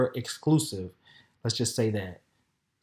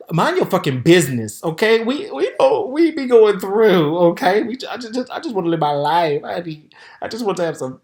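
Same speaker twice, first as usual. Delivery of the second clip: defensive outburst; 7 semitones higher, 8 dB louder than usual. Real, not acted. Snapping at judging viewers.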